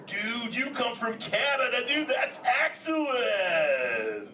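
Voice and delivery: high, stretched surfer enthusiasm; be totally blown away, dude; amazed turtle, drawn-out ending; stoked surfer amazement. Acted. Amazed at child's home country.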